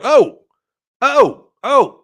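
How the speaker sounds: high, punched exclamations; startled first-sip reaction, played up; acted surprise.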